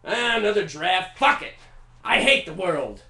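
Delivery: loud, exasperated ranting; sick of every draft, fed up with the world; loud, despairing composer rant; Beethoven frustration. Retold or imitated. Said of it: "threw it away".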